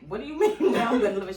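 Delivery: fast, loud, high protest; indignant disbelief. Retold or imitated. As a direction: fire back in disbelief. Shocked at being told leave.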